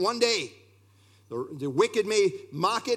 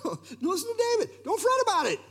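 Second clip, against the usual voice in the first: pleading; 10 semitones higher, faster than usual. Acted. Preacher answering imagined objection.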